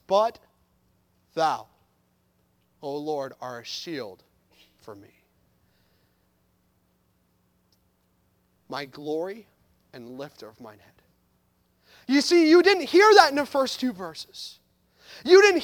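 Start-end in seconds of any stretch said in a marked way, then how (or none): 0.1-11.0 "But thou, O Lord" much softer and lower, with long pauses; hushed reverent reading. Shown as a demonstration. Preacher reading the psalm to the congregation in a hush. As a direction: breathe the verse out low and reverent. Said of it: "we need a different approach".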